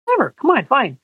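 high, airy mock shrug; playfully retelling his own impatience; dismissive brush-off. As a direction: toss it off like it doesn't matter. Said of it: "I said".

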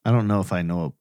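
usual speaking voice

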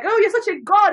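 high, lightly voiced flatterers; mock the crowd hailing Herod; a hint of fawning worship. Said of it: "they're like".

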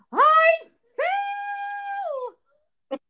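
high, loud melodramatic cry; drama queen wail. Retold or imitated. Daughter phones mother from the icy driveway, mock wailing. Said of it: "she's the worst drama queen ever".

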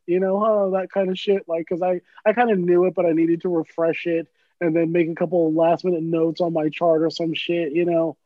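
normal talking voice

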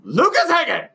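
very high loud full-name call; shouting. Real, not acted. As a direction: bellow his full name. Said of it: "do it again".

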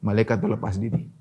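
ordinary delivery

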